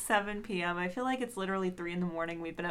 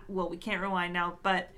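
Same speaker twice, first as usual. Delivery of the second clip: ordinary delivery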